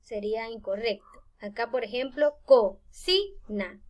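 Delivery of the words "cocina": slow cocina, one voice pulse per syllable. Shown as a demonstration. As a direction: break cocina into separate beats, co-ci-na. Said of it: "cada golpe de voz separa una sílaba".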